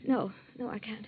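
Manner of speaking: very soft, low and drained; faint refusal. Acted. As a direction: refuse weakly, voice nearly gone. Refusing food, fearing poison.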